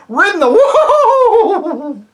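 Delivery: high, loud whinnying neigh through the words; angry horsey neigh; horse neigh. A narrator's reading. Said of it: "it came out as sort of a neigh".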